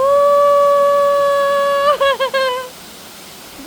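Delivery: long, high, loud laugh of relief; relieved laugh. Real, not acted. Vlogger safely across the bridge, long relieved laugh. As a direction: laugh it out after the crossing.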